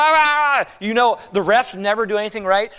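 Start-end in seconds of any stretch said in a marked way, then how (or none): 0.0-0.8 loud, higher mock yelling from the stands. Parents in the stands yelling at the refs, loud mock jeering. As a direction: jeer like an angry parent in the bleachers. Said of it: "people yelling".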